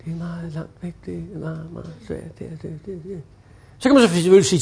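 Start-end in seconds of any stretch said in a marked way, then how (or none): 0.0-3.2 very soft, faint, far-off mumble; quiet customer voice fading out; barely audible. Shown as a demonstration. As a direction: mumble as if from far away on a bad line. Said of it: "Jeg kan næsten ikke høre".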